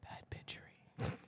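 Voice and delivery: hushed intimate intro whisper. Acted. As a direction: whisper it like a secret. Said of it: "Why are you whispering?".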